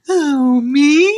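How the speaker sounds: mock-innocent surprise; oblivious victim. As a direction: ask it cluelessly, as if flattered. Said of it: "And then".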